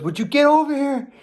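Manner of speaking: high, loud imitation of an impatient dad; mock holler. Dad calling his son over from the dock, mock holler. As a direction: holler it like an exasperated father.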